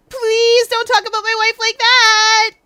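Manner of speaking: high, loud, drawn-out protest; distraught wail. Acted. Upset husband objecting to talk about his wife, distraught wail. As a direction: wail it out at full volume.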